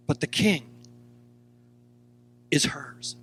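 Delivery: hushed whisper. Acted. Preacher whispers to the congregation that the King is hers. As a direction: breathe it out like a secret. Dramatic hush about the King.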